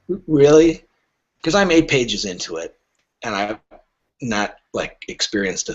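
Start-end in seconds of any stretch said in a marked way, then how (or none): 0.1-0.8 a skeptical, louder really; doubting the claim out loud; mock disbelief. Agent reacting to a dull thriller, mock disbelief. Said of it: "And I'll be like".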